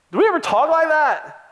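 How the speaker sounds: high, amused disbelief; incredulous question. Real, not acted. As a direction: ask the church if anyone really talks that way. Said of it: "rhetorical question to congregation".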